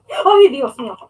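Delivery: sudden loud startled cry; scared exclamation. Real, not acted. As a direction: blurt it out in fright. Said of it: "when I'm scared".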